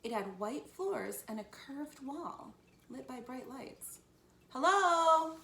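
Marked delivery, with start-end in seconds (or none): 4.5-5.4 loud, high, drawn-out hello; calling out. A narrator's reading. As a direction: call into an empty place. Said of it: "Annie called".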